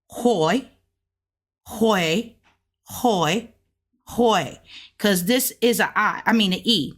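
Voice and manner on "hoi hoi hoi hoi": hoi repeated, each one slightly up; repeated syllable. Shown as a demonstration. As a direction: drill hoi over and over. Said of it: "and this is".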